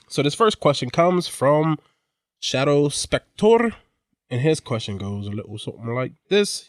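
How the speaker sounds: ordinary talk, nothing marked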